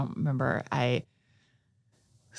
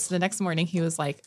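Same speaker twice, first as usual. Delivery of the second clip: ordinary talk, nothing marked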